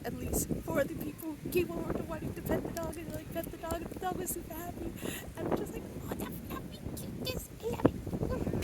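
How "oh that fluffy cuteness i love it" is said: gushing squeal. Retold or imitated. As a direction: melt over a cute dog. Gushing over the fluffy dog.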